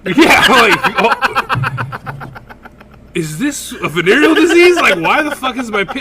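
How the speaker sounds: high, loud alarmed babble; high, loud hammed-up alarm; mock panic. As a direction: panic at the burning pee. Retold panic while peeing.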